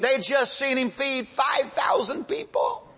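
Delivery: rising high into a strained shout; incredulous exclamation. Real, not acted. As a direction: build to high-pitched disbelief. Amazed at the disciples' doubt.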